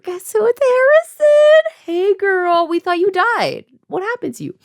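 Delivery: high, loud gleeful announcement; a hammed-up high-pitched best-friend greeting; excited reveal. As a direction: squeal the big reveal.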